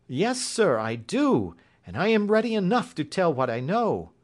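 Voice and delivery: high-pitched servant woman; Mrs Toller, willing. Acted. Voices Mrs Toller in story.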